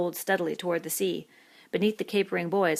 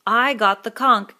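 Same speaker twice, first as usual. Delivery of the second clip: insistent; 5 semitones higher, 13 dB louder, slower than usual. A narrator's reading. Piggy insisting, ignored.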